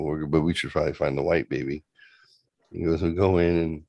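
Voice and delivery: ordinary talk, nothing marked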